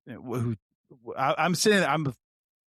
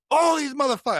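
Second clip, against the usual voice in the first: high-pitched, heated yell; retold yelling; 13 semitones higher, 4 dB louder than usual. Officer answering who's going to jail, yelling. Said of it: "I was yelling".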